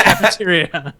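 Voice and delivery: normal talking voice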